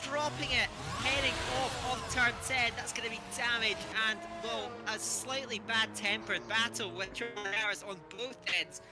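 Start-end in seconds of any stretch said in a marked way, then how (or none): none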